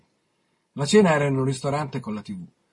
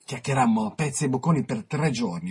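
ordinary delivery, nothing marked

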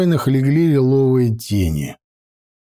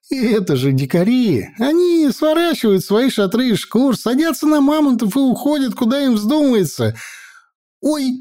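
much higher, fast nervous babble; nervous servant; 12 semitones higher than usual. A narrator's reading. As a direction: gabble excuses, ending in a yelp. Frightened servant rambling.

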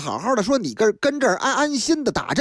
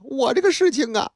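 ordinary delivery

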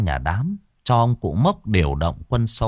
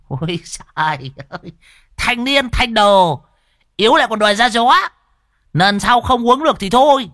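high, loud mocking put-on voice; teasing a friend who staggered, in a high loud mock voice; 9 semitones higher, 4 dB louder than usual. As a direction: ham up a jeering old friend. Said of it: "Lão Đồng mới trêu".

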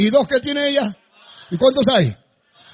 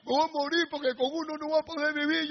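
a hint of a whimpering, self-pitying man, softer and higher; mock crying; 5 semitones higher, 8 dB quieter than usual. Retelling a man who cried over one kidney, mock whimper. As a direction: play the man mildly wailing over his kidney. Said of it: "y lloraba".

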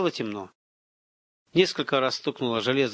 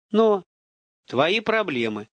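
slow, firm dismissal; drawn-out unyielding brush-off; harsh cut-off; 6 semitones higher, 3 dB louder, slower than usual. A narrator's reading. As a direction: cut him off hard. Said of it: "жёстко отрезал Руслан".

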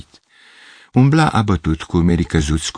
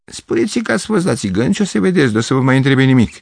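conductor's voice pitched much higher, playful; teasing conductor; 7 semitones higher than usual. Acted. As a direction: tease him with a wink in the voice. Conductor winks, teasing advice. (taxator șugubăț, cu voce mult mai subțire)

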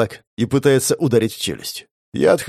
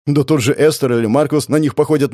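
usual speaking voice

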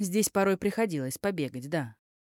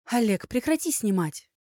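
higher, sharp urgent order; urgent scolding; 6 semitones higher, 3 dB louder than usual. Acted. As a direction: stop him filming, in a panic. Elya hisses at cameraman.

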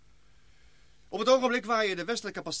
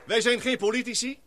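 emphatic orator; 6 semitones higher, 5 dB louder than usual. Acted. Rousing speech to the meeting.

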